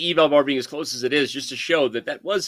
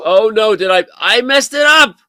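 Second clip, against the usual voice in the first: loud, high-pitched self-reproach; dismayed outburst; 6 semitones higher, 8 dB louder than usual. Real, not acted. Commentator realizing they messed it up, loud dismay. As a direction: cry out in sudden dismay.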